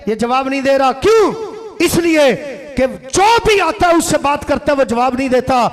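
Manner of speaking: loud, high-pitched impassioned sermon delivery; full-force preaching. Acted. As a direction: hammer each phrase out to the crowd. Preacher acts out worshipper's silent answer. (पूरे जोश में ऊँची आवाज़ में तक़रीर)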